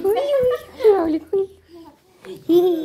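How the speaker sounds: playful squeal. Real, not acted.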